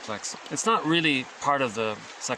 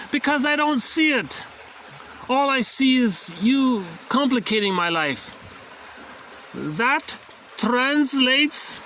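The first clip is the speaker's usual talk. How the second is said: put-on slow, high playful German accent; mock German analyst, drawn out and raised; 10 semitones higher, 5 dB louder, slower than usual. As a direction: play the doctor with a hammed-up German accent. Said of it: "he has a German accent, but just for fun".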